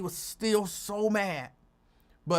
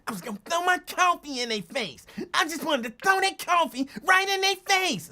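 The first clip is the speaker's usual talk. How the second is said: angry; 10 semitones higher, 5 dB louder than usual. Retold or imitated. Angry man retelling coffee incident.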